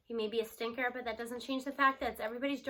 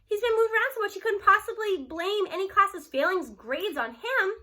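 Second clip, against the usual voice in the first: high, loud, outraged disbelief; appalled protest; 8 semitones higher, 7 dB louder than usual. Acted. Teacher appalled at her boss's plan, loud protest. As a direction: rise up in outrage for a friend. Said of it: "I'm appalled".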